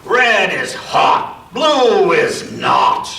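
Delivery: slow, taunting singsong of a bully; slow schoolyard taunt. Acted. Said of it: "he'd say".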